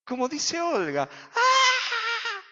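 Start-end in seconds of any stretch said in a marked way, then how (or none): 1.3-2.4 high, loud imitated cry of a crowd; mock battle cry, much higher; crowd scream. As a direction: let out the people's shout. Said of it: "Y gritaban".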